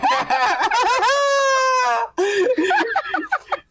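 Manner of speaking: long, high, wheezing laugh fit; helpless laughter. Real, not acted. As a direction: uncontrollable high-pitched laughing.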